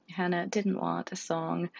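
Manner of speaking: usual speaking voice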